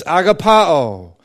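"agapao" as ah-gah-PAH-oh, stress on PAH; corrected stress. Shown as a demonstration. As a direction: lean on the third syllable of "agapao", PAH. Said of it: "putting the emphasis on the wrong syllable".

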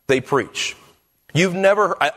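ordinary talk

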